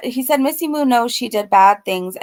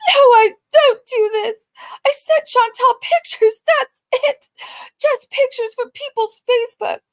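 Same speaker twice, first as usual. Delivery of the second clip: hammed-up wailing denial, much higher and louder; mock crying; 14 semitones higher, 3 dB louder than usual. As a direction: sob and protest in fake tears. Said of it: "She says".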